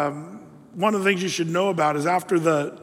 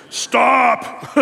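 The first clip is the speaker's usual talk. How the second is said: booming, higher mock-divine shout; huge loud voice; 5 semitones higher, 5 dB louder than usual. Preacher as the Lord halting someone, huge loud voice. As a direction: shout it suddenly like a command from above. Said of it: "the huge, loud voice of the Lord".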